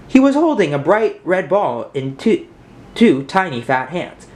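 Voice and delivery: usual speaking voice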